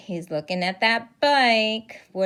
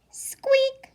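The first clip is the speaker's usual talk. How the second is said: high whispered squeak; whispery mouse peep; tiny mouse squeak. Acted. Voices the mouse.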